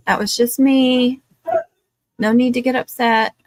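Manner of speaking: loud reassurance. Real, not acted. Telling someone startled by a kicked table not to worry, reassuring. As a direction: call out that all is fine.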